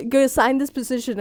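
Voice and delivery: ordinary delivery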